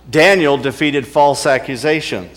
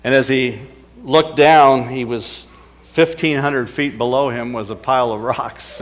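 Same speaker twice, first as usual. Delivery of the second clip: normal talking voice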